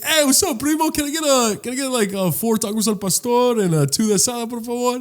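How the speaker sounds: high, chummy put-on voice; eager friendly ordering. Replaying his order at the taco stand, eager friendliness. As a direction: order as if greeting an old pal.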